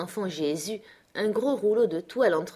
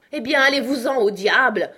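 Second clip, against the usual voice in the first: higher, louder angry exclamation; exasperated outburst; 5 semitones higher, 7 dB louder than usual. A narrator's reading. Benjamin flinging the roll across the room, exasperated outburst. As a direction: burst out in exasperation. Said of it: "s'écria Benjamin".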